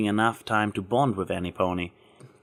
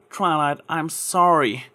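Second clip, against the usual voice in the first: apologetic; 6 semitones higher, slower than usual. Acted. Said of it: "Applejack apologized".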